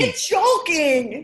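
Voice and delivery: high, loud, drawn-out English accent. Shown as a demonstration. Guest copying the host's hammed-up English accent. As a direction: crow it in broad comic English. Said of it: "your best English accent".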